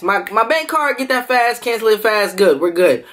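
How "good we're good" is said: ordinary talk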